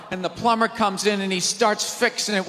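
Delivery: ordinary talk, nothing marked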